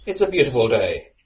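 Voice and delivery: bellowing. Acted. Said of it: "I bellowed".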